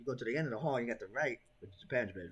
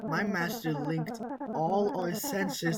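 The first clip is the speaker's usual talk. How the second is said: creepy doll voice; 10 semitones higher, 4 dB louder, slower than usual. Acted. Doll character speaks.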